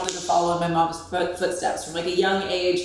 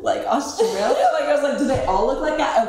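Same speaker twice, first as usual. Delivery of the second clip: higher, giggly questioning reaction; giddy interest; 7 semitones higher, 4 dB louder than usual. Retold or imitated. Model replaying her delight at Australian men, giddy interest. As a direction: let a laugh lift the question.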